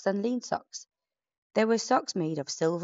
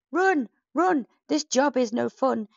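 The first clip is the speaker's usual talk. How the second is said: much higher, louder panicked shout; cowardly yell; 8 semitones higher, 5 dB louder than usual. Acted. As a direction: flee screaming like cowards. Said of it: "yelled the cowardly villainous pack".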